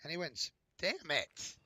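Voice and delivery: squeaky, cracking voice. Real, not acted. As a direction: voice squeaking up on the words. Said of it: "stop sounding like a squeaky pubescent boy".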